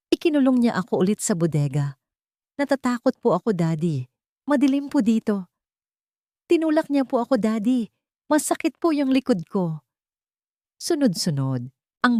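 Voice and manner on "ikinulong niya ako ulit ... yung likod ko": put-on high little girl's voice, scared and pleading; a small child crying out to her daddy, much higher; innocent frightened child. A narrator's reading. Little Dalisay begging her father on the recording, high and scared. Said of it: "Ang inosenteng boses ni Dalisay".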